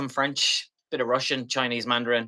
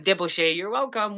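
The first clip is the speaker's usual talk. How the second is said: much higher, sing-song Deboche, you're welcome; bright translation; 8 semitones higher than usual. Shown as a demonstration. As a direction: toss out the phrase playfully high. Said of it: "that's like".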